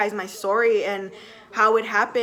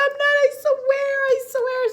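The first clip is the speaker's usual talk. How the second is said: high, loud pleading denial; telling husband she's not lying, tearful and high; retold tearful insistence; 3 dB louder than usual. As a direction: insist through tears, pitch up. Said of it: "And I started crying".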